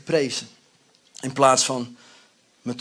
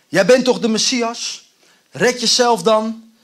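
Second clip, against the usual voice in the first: mocking; 6 semitones higher, 7 dB louder than usual. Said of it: "Die zegt spottend tegen hem".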